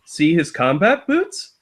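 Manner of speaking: lightly sing-song storybook read; children's book narration. Acted. Friend mock-reads the creepy kids' book aloud, sing-song.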